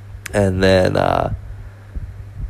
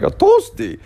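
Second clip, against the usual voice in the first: put-on very high squeaky German-accented answer; friend's German-accented answer to what he is getting; thick German accent. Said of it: "he had this thick German accent".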